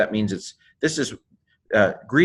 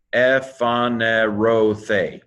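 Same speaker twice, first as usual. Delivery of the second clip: "Ephanerothe" slowly, syllable by syllable, stressed on RO; much slower than usual. Shown as a demonstration. Teacher shows the accent mark, "Ephanerothe" slow with stressed O. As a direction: sound out "Ephanerothe", leaning on the long O. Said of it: "see how I put the stress on the O".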